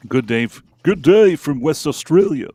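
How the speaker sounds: put-on a jokey Australian accent read of a fan's hello. Reading a listener's greeting in an Australian accent. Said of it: "when I try to do a, a Australian accent".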